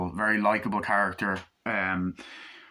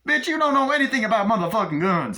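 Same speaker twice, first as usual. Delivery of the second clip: much higher, loud hammed-up tough guy; mock gangster yell; 13 semitones higher, 6 dB louder than usual. Acted gangster dialogue from movie.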